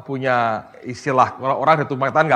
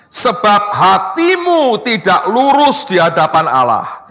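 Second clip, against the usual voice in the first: raised, ringing pulpit reading; forceful scripture reading; 4 semitones higher, 6 dB louder than usual. Acted. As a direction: read the verse out loud to the congregation. Reads scripture aloud forcefully.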